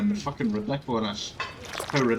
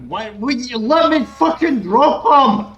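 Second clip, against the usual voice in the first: loud, higher, slow complaint; angry shout; 9 semitones higher, 8 dB louder, slower than usual. Real, not acted. Streamer yelling because he can't drop the survivor, angry shout. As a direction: bellow at the game.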